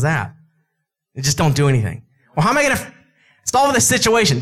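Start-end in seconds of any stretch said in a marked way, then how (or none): 2.4-4.4 high, whiny worried voice; hammed-up panicky question; mock fretful believer. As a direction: play the anxious one who can't cope.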